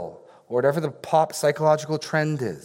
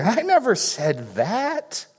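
high, slow, wide-eyed innocent voice; mock innocent, higher, sly deniability; mock innocent denial; 8 semitones higher, slower than usual. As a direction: fake innocence with a rising I never. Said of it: "leaving ourselves plenty of plausible deniability".

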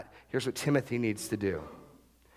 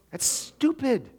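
scornful; 12 semitones higher, slower than usual. Real, not acted. Pastor mocking Adam's fix.

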